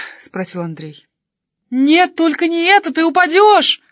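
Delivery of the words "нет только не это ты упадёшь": high, very loud cry of alarm; terrified yell. A narrator's reading. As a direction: scream to stop him before he falls. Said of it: "заорала я".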